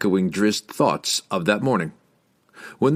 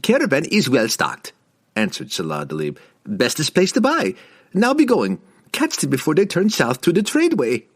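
higher, lively market trader; merchant voice; 7 semitones higher than usual. A narrator's reading. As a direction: hawk it like a desert merchant. Merchant's voice in the story.

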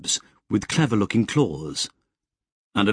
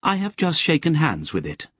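higher, lightly voiced crisp machine mind; 7 semitones higher than usual. A narrator's reading. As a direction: chatter it out crisply. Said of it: "Lobsang said".

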